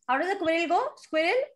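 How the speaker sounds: ordinary delivery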